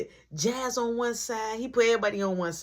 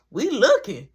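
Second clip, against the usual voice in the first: ordinary talk, nothing marked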